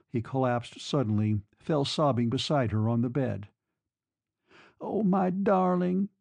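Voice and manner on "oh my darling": high, slow, tender plea; begging. A narrator's reading. Woman soothing her sobbing lover, begging tenderly. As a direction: beg him lovingly, drawing the words out. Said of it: "she begged him".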